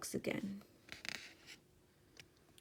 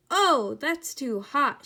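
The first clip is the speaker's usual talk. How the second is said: high, loud, drawn-out cry of complaint; 6 semitones higher, 8 dB louder, slower than usual. A narrator's reading. Goldilocks tasting the great big bowl, crying out. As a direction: cry out loudly over each word. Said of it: "she cried".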